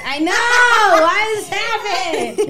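high, loud, laughing it out. Real, not acted. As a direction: break into laughter mid-question. Laughing at a running joke.

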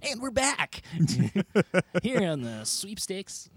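high, slow hammy sportscaster. Acted. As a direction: announce like a goofy slow-mo commentator. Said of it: "names for sportscasters and being like".

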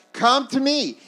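loud, high-pitched summons; shouting. Acted. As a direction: shout it over the festival noise. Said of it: "he's shouting".

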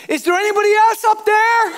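high, loud mock-desperate shout upward; calling out. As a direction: holler up the cliff for someone else. Dangling man calls up to heaven.

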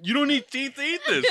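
high-pitched, laughing through the words. Real, not acted. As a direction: crack up at your own joke while talking. Laughing at his own old-man bit.

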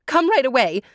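high, urgent summons; acted plea for help; breathless cry. A narrator's reading. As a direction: call someone over in a hurry. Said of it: "she cried out of breath".

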